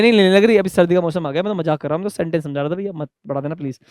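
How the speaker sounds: normal talking voice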